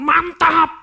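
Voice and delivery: high, loud "Mantap!"; loud congregation call; shouted cheer. Retold or imitated. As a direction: cheer it out like a hype preacher.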